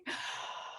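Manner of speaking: sharp unvoiced gasp, imitated; mock gasp. Client gasping as she tells coach how she feels. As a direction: suck the air in like a startled friend. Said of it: "She's like".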